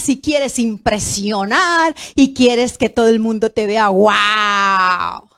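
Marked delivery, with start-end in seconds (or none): 3.9-5.3 big showy gasp of awe; mock wow. Preacher mocking how we gush over rich guests, showy wow. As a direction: act dazzled by the rich visitor.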